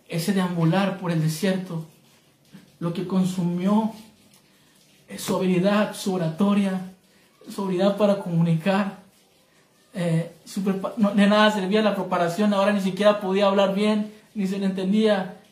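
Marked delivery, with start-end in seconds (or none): none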